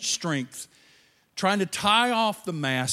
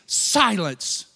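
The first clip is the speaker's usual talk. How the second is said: commanding rebuke; 4 dB louder, slower than usual. Acted. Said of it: "said to the waves".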